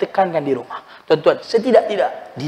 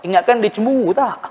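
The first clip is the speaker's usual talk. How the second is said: ordinary delivery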